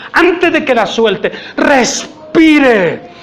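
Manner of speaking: ordinary talk, nothing marked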